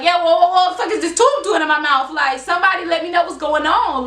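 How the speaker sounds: high, loud alarmed protest; retold panic. Patient waking with tube in mouth, retold panic.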